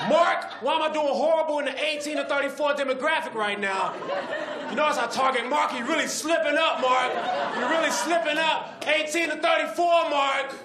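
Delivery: high, loud shouting boss; boss yelling. Acted. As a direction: rip into Mark. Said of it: "He's yelling at people".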